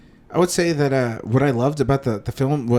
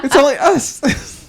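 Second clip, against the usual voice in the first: laughing; 12 semitones higher, 6 dB louder, slower than usual. Real, not acted. Laughing tease.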